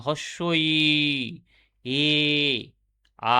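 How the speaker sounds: drawn-out ই, এ, আ with the tongue spread; teacher slowly sounding out ই... এ... আ, each vowel sustained; demonstrating spread vowels. Shown as a demonstration. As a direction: hold ই, এ and আ long, one at a time. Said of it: "এই স্বরধ্বনিগুলো উচ্চারণ করতে গেলে".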